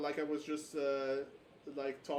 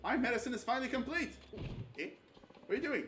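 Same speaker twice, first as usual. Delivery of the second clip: excited game character; 9 semitones higher, faster than usual. Acted. Voicing game character lines.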